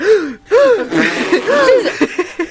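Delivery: sharp high gasp of shock. Retold or imitated. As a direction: gasp in disbelief. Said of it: "I was just like".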